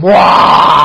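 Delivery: booming shout of the name; loud aggressive surname. Acted. Host shouting a listener's surname to thank him, loud and aggressive. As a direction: roar the name like a war cry. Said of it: "pronounce very loud and aggressively".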